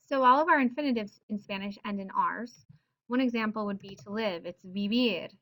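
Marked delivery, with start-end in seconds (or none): 4.6-5.3 "vivir" leaning on the "vir"; stress on last syllable. Shown as a demonstration. Teacher demonstrating to live, "vivir" stressed on last syllable. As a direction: land on the final syllable of "vivir". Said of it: "Stress is on the last syll".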